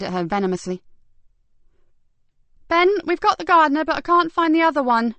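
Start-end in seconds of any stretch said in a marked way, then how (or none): 2.7-5.1 high, loud report; calling out. Acted. Constable calling to Ben from the garden. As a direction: shout across the house.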